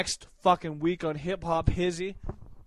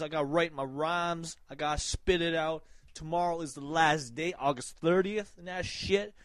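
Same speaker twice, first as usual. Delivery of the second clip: ordinary delivery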